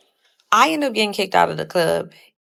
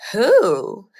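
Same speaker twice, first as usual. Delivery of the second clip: higher, louder retold question; drawn-out, pitched-up question in retelling; 4 semitones higher, 5 dB louder than usual. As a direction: demand the name, re-enacted. Acting out her shocked questioning.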